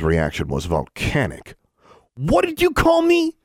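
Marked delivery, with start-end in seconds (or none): 2.2-3.4 very high, angry yell; outraged yell. A narrator's reading. David leaps up at the slur, outraged yell. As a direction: explode in high-pitched outrage. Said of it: "he yelled".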